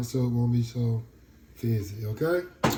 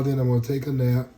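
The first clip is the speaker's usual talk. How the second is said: ordinary talk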